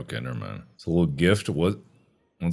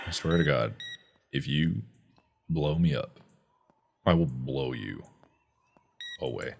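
soft, low menacing vow; low quiet threat; 11 semitones lower, 11 dB quieter than usual. Acted. Quiet threat after the yell.

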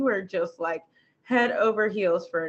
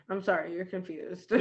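dismissive brush-off; 2 semitones lower, 9 dB quieter than usual. Pretend. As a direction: wave it off quietly. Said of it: "I'd be like".

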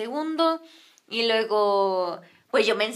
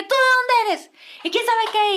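high, raised interrogating voice; ranting wife; 10 semitones higher, 5 dB louder than usual. Retold or imitated. Señora grilling the stranger, mock rant. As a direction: imitate an accusing woman. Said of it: "empezó la señora de que".

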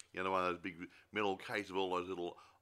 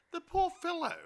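a much higher, louder, hammed-up "poor fellow"; mock pity; 9 dB louder than usual. Host mock-pitying the jealous lord, hammed-up sympathy. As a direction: swoop up in exaggerated sympathy.

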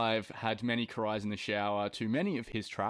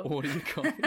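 laughing; 5 semitones higher, faster than usual. Pretend. Mocking belted coffee order.

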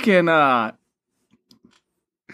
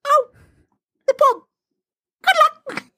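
hammed-up high-pitched Yvonne impression; imitating Yvonne. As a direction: squeal it up high as a cheery send-off. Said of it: "Yvonne just going".

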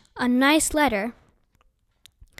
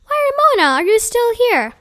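surprised; 9 semitones higher, 4 dB louder than usual. Acted. Teacher surprised to find her.

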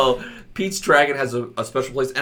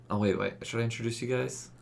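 ordinary talk, nothing marked

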